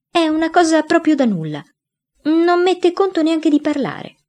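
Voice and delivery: voce acuta da ragazzino che minimizza; voce di ragazzo. A narrator's reading. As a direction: Gian Burrasca che fa l'innocente, più acuto. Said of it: "dissi io". (voce acuta da bambino che si giustifica)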